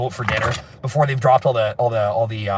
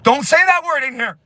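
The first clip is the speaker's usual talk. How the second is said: high, loud outburst; mock scolding shout; 13 semitones higher, 5 dB louder than usual. Scolding someone for saying a banned name, mock outraged yell. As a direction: yell it like a scandalized enforcer.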